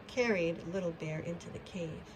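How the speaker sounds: ordinary delivery, nothing marked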